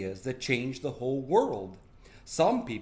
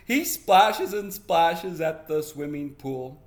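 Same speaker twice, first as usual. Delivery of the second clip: slow, loud, higher little-sister voice; tattling little sister; 5 semitones higher, 8 dB louder, slower than usual. Acted. Little sister contradicting her brother about dad, tattling. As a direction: tell tales loudly, drawing the words out.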